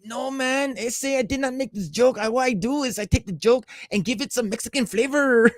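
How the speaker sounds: high-pitched mock Mexican accent; hammed-up Mexican accent, protesting innocence; Carlos Mencia. Accused comedian explains his joke stealing, mock Mexican accent. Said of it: "his own Mexican accent".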